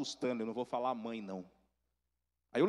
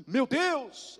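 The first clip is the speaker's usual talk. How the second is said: gasp, then a high, louder exclamation; startled; 12 semitones higher, 4 dB louder than usual. Retold or imitated. Aunt opening the door to a long-lost nephew, startled. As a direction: act out a shocked gasp and cry. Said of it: "ela levou um susto".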